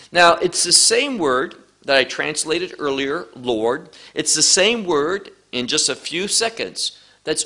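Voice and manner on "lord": ordinary delivery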